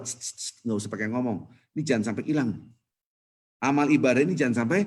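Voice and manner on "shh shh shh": soft, voiceless shh sounds; repeated shushing. Shown as a demonstration. Preacher urges doing good without talking, soft repeated shushing. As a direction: hush them with short repeated shushes.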